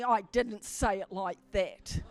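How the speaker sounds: low, slow mock protest, lightly amused; husband's imagined protest. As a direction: drop low and deliberate, imitating his objection. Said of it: "He's probably gonna say to me".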